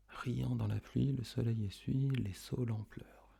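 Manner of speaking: hushed, near-whispered verse; soft echo. Shown as a demonstration. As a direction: murmur the line softly along with the pupils. Soft echo in pupils' turn.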